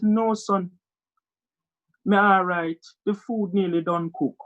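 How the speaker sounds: Jamaican mother impression; easygoing Jamaican patois; Jamaican accent. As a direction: put on a Jamaican mum's accent. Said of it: "See the Jamaican there?".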